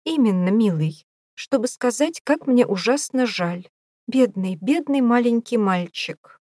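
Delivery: high, fake-sweet cooing; kindergarten teacher voice. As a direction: pity him like a small child. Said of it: "в неискренней ласке".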